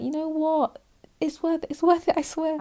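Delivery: squeaky, pleading self-justification; high self-convincing voice. Retold or imitated. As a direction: talk yourself into it, way up high. Justifying impulsive purchase to herself.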